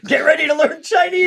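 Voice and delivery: laughing high-pitched villain line; mock menacing Vader, cracking up; Vader impression. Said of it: "It would be amazing if Vader had been like".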